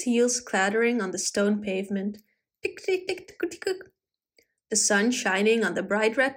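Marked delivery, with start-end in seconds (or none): 2.6-3.8 high, soft little ticks; imitated heel clatter. A narrator's reading. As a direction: tap out tiny footsteps with the voice. Imitates heels clattering on pavement.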